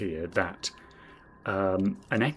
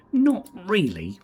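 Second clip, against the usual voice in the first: high, louder, slow drawn-out posh denial; mock posh denial; 10 semitones higher, 5 dB louder, slower than usual. Asked if he is posh, mock-posh drawn-out denial. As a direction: drawl it like a toff brushing off the question. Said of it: "Are you posh like Juan Lebedev?".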